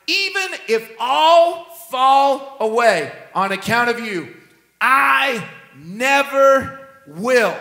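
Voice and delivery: a slow, emphatic boast; Peter's bold vow. Acted. Peter's boast quoted from scripture.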